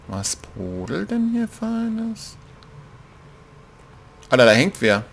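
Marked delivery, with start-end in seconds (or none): 0.1-2.4 soft curious murmur. Pretend. Sneaking past a pot.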